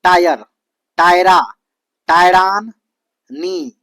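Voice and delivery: टायर, टायरा, टायरान loud, building the word piece by piece; emphatic teacher sounding out टायर, टायरा, टायरान, नी; syllable-by-syllable build-up. Shown as a demonstration. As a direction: pause after each piece, then add नी separately. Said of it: "तो कैसे याद रखेंगे देखिए".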